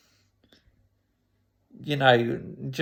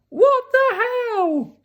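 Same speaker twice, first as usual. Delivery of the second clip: very high, drawn-out incredulous squeal; exaggerated high-pitched bewilderment; baffled outburst; 4 dB louder, slower than usual. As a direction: shriek it in comic disbelief. Retelling baffled reaction to question.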